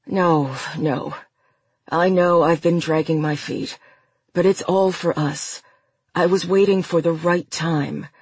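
plain reading voice

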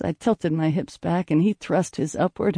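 plain reading voice

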